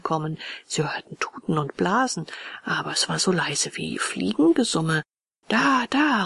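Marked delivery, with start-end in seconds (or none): 5.5-6.2 higher, raised cry of discovery; excited call. A narrator's reading. Friend spots the coach coming, raised excited call. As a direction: call out on spotting something. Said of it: "rief Röhrle".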